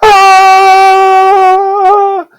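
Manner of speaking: very high, long held "ah"; sustained high squeal of "ah"; high-pitched "ah". Shown as a demonstration. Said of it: "Notice higher pitches, it's closer together".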